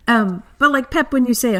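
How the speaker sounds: ordinary talk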